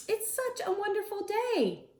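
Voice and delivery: high, sing-song cheer; lightly voiced cheerful bird. Teacher voicing Marabou, lightly high and cheery. Lemur's cheerful character line.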